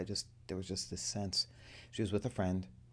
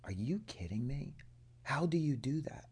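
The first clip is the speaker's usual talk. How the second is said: hushed, awed "are you kidding me"; whispered amazement; stunned whisper; 7 semitones higher than usual. Retold or imitated. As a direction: breathe it in disbelief. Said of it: "she looked at me and she's like".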